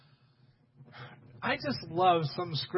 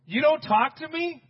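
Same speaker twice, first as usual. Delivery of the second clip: higher, louder incredulous question; indignant demand; 8 semitones higher, 6 dB louder than usual. Acted. Pilate to a silent Jesus, demanding an answer. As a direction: demand it as an affronted ruler. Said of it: "Pilate demanded".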